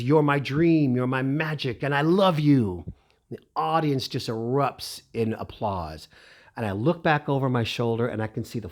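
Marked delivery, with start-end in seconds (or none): 0.0-2.9 raised, grand diva declaration. Retold or imitated. Stage star answering her young fan, grand impersonation. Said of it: "in that [name] voice".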